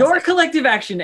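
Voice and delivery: laughing through the words; cracking up while repeating it. Real, not acted.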